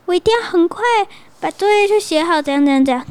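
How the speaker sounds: high, eager vow; determined kid. Acted. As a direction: promise it eagerly. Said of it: "他就说". (孩子发誓要快写作业，很起劲)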